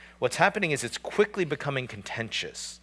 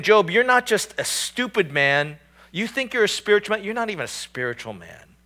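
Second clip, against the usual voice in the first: insulting; 6 semitones higher, 4 dB louder than usual. Retold or imitated. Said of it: "it's incredibly insulting".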